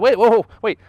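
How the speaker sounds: sudden loud whoa; spotting the dent in alarm. Retold or imitated.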